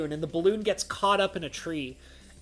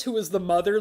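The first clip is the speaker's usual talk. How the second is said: ordinary talk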